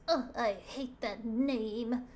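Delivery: slow, groaned villain's complaint; disgusted devil. Acted. Devil hearing Jesus named, disgusted villain voice.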